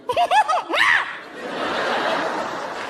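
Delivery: very high, giddy squeal of laughter; gleeful laugh. Acted. Rider thrilled there are no bars, giddy squeal. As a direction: burst into high-pitched glee. Gleeful reaction to ride news.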